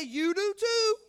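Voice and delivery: loud, high call to the neighbour; preacher urging the church to call out to their neighbour, loudly; playful shout. Shown as a demonstration. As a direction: shout it at the person next to you. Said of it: "Look back at that person and say".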